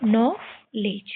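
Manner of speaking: Knowledge drawn out slowly, d silent; careful classroom pronunciation; slow final reading. Shown as a demonstration. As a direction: land the word slowly as a summary. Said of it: "डी को silent करके".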